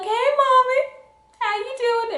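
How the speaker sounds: butter mom up with a sugary high voice; sugary daughter voice. Retold or imitated. Sweet-talking mom on the phone.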